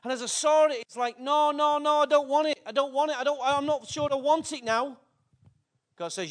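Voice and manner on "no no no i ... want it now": panicked refusal. Acted. Acted refusal to God.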